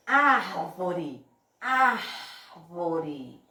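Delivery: "árvore" with a strong, long AR, then falling away; stretched forceful first syllable in "árvore", low ending; stress on first syllable. Shown as a demonstration. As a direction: push hard on the opening "ár" of "árvore". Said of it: "Olha o que foi que eu botei mais força pra falar".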